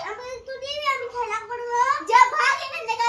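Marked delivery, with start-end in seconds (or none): 2.1-3.0 loud, acted sibling rebuff; angry shooing. Sister refuses brother the balloon, angrily shooing him. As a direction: chase the brother off loudly.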